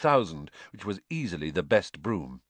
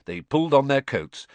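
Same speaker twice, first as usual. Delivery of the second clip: plain reading voice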